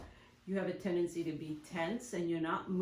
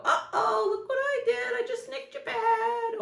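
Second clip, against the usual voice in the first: much higher, louder and light-hearted; cheerful reassurance; 6 dB louder than usual. Shown as a demonstration. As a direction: make the accident sound like nothing.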